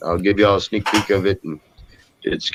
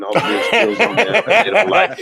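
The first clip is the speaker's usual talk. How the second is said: laughing; 10 semitones higher than usual. Real, not acted. Laughing while telling story.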